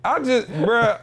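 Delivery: high, loud exasperated bruh; exasperated disbelief. Real, not acted. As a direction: let the disbelief spill out. Exasperated at son's prank.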